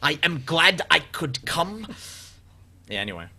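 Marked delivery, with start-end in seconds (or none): 0.0-1.9 slow German-accented mimicry; young Werner Herzog. Host mimicking the player's accent back, slow Herzog impression. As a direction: draw it out in a mock German accent. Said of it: "like a young Werner Herzog".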